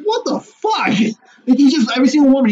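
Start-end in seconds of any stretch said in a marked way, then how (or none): 0.0-0.9 much higher, stunned outburst; disbelieving what the fuck. Retold or imitated. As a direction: recoil in disbelief. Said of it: "I was like".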